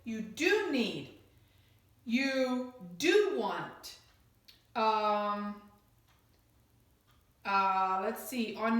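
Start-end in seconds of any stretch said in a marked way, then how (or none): 0.0-1.1 slow, with "do" swooping up then falling; deliberate statement, stretched stressed "do"; emphatic DO. Shown as a demonstration. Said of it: "This is emphatic". 2.0-4.0 very slow, big rise-fall on "do"; deliberate example with "do" stressed; emphatic DO. Shown as a demonstration. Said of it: "This is emphatic".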